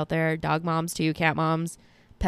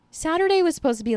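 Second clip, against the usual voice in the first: excited; 10 semitones higher, 4 dB louder, faster than usual. Real, not acted. Excited jump in pitch.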